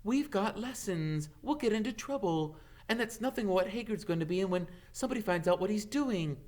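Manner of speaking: a lightly voiced worried girl, quick; Hermione's fussy voice. Hermione's voice, different timbre.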